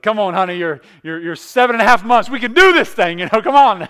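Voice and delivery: loud, high hammed-up coaxing; mock urging wife. Husband coaxing pregnant wife to deliver, loud and high. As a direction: urge the baby out before New Year. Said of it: "I was like".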